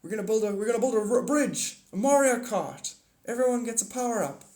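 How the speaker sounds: lightly voiced mocking Biden impression. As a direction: parody the president's rambling speech. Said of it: "what Biden was saying".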